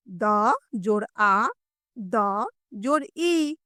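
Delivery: द, जोड़, आ, then द, जोड़, ई, slowly; long separate आ and ई after each द; sound-by-sound spelling. Shown as a demonstration. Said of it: "इस तरह से समझेंगे". (द, आ, ई धीरे अलग-अलग बोलना)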